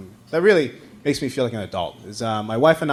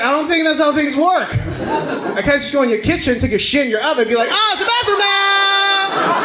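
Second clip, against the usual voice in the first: indignant; 13 semitones higher than usual. Retold or imitated. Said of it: "I'm like".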